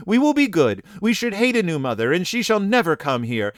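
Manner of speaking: put-on high, fervent children's protest; crying out. A narrator's reading. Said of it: "they cried".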